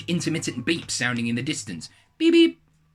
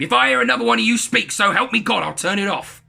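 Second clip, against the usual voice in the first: loud, high-pitched acted threat; exasperated dad exclaiming; 11 semitones higher, 8 dB louder than usual. A narrator's reading. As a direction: lay down the law as a fed-up dad. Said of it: "My dad exclaimed".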